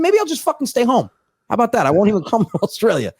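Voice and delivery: high-pitched retold refusal; mild exasperation. Comic refusing his Australian mate, mock exasperated and high. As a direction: pitch it up, mock giving up on the trip.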